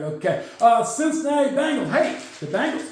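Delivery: ordinary talk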